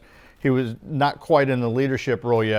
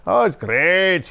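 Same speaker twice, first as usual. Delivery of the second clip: mock sunshiny; 4 semitones higher, 4 dB louder, much slower than usual. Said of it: "you know, like".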